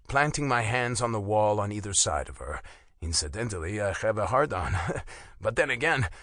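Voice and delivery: ordinary delivery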